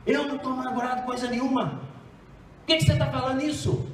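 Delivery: bitter angry denial. Retold or imitated. Embittered person denying their bitterness, angrily. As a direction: bristle and deny it fast and sharp. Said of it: "ela diz com ódio".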